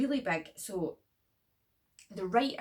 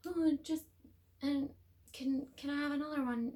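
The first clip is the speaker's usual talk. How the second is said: much higher, pleading mock voice; whiny greedy girl; 10 semitones higher than usual. Mocking the girl asking friends for another burger, high and whiny. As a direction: beg in a childish high whine. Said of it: "she's like".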